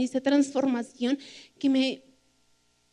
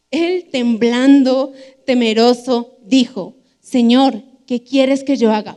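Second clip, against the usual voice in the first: loud, deliberate Bible reading with weighted pauses; emphatic scripture reading; 6 dB louder than usual. Acted. Preacher reading Saul's conversion to the congregation, emphatic scripture reading. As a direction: proclaim the passage firmly, phrase by phrase.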